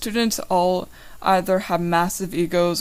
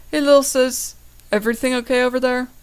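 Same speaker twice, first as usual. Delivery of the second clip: loud friendly call; 6 semitones higher, 4 dB louder than usual. Acted. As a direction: call across to your sister. Brother calls from afar.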